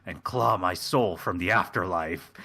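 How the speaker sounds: low, grave voice; Shaxs recounting death. Acted. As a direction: tell of your death and resurrection in a low solemn tone. Quoting Shaxs recounting his death.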